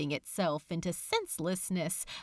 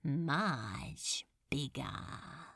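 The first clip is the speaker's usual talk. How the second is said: slow breathy whisper of relish; gloating whisper; 7 semitones lower, 8 dB quieter, much slower than usual. Acted. Demon lord whispering a threat to Crowley, slow and gloating. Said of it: "His eyes widened in anticipatory pleasure".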